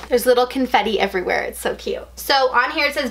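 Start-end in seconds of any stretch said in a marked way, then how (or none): 1.4-2.1 whisper. Real, not acted. Admiring the confetti.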